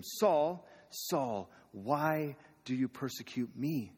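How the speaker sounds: lightly voiced call from heaven, slow at the end. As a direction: call his name, then ask slowly. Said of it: "heard a voice say to him".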